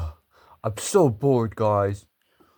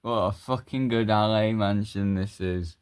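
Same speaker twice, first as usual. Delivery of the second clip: ordinary talk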